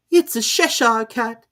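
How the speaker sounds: high, slow, imperious lady; grand and dismissive; Duchess. A narrator's reading. Duchess figure voice.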